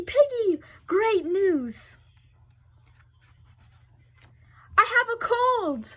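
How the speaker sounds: excited. Acted. Gerald delighted by diagnosis.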